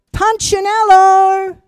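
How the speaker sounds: high, loud, slow call from afar; mysterious voice calling. A narrator's reading. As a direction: call his name from somewhere unseen. Unseen maker calls his name.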